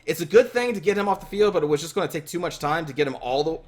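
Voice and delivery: ordinary talk, nothing marked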